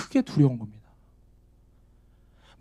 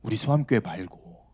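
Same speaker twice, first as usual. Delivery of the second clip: mock hedging aside; 7 semitones lower, 10 dB quieter than usual. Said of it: "돌려서 이렇게 얘기해요".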